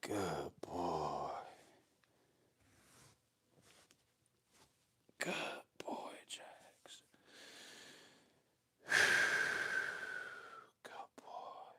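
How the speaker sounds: soft whispered praise, drawn out; hushed praise. Real, not acted. Trainer praises Jax balancing on the back, slow soft whisper. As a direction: whisper it soothingly to a dog. Soothing the dog during the trick.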